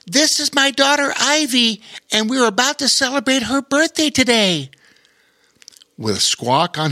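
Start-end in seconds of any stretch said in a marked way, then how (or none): 0.1-4.7 cheering woman. A narrator's reading. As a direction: call out in delight. Voices the tall woman.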